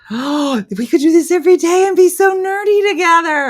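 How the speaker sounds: high, loud mock gushing; hammed-up giddy enthusiasm; gushing excitement. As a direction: squeal it like an over-eager fan. Mimics a viewer eager to join.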